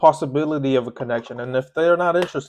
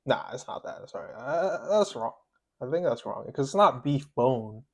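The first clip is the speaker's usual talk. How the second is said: quiet muttering; 11 dB quieter than usual. Real, not acted. Quiet self-correction.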